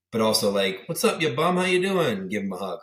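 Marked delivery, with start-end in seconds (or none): 0.9-2.3 high, cheery buddy greeting; hearty dad greeting, pitched up; dad's hearty greeting. Retold or imitated. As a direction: call out like a proud dad. Dad greeting son warmly.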